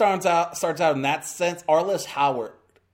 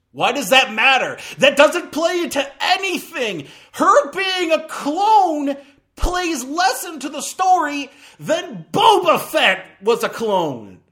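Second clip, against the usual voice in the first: exasperated rant; 10 semitones higher, 7 dB louder than usual. Real, not acted. Exasperated rant about the clone twist.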